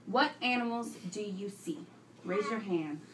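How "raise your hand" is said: ordinary delivery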